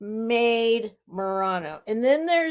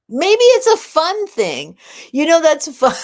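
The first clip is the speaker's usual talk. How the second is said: bright burst, higher and louder, slowing down; excited; 7 semitones higher, 9 dB louder than usual. Real, not acted. Cheering herself up about Patsy's hospital stay, excited. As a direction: lift it up, suddenly hopeful.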